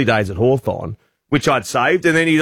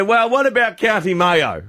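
higher, laughing, hammed-up footy chat; mock matey Irish small talk; 6 semitones higher than usual. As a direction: chat him up like a fellow Mayo fan, half laughing. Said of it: "And I said".

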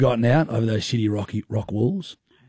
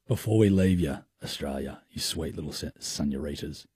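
hushed; 8 semitones lower, 8 dB quieter than usual. Acted. Host's hushed sign-off aside.

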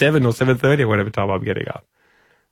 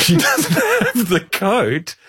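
words break up into higher, drawn-out laughter; laughing through words; 7 semitones higher, much slower than usual. Real, not acted. Host cracks up telling co-host about carrying the coat, laughing. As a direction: tell it while cracking up.

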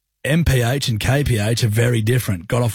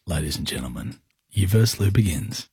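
dramatic announcer; 5 semitones lower, 4 dB quieter than usual. Acted. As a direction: build suspense like a host. Quiz host announcing start.

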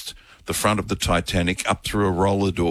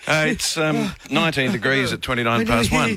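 suppressed giggles; 7 semitones higher than usual. Real, not acted. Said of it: "when you get the giggles and can't recover".

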